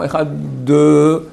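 Ehad deux, the d stretched out slowly; wrong Ehad deux. Shown as a demonstration. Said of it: "beaucoup de gens se trompent". (Ehad deux traîné, fautif)

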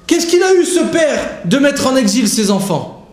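high, pleading lament; lamenting cry. Acted. Teacher voicing the grieving father, high and lamenting. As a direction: cry out over the exiled children.

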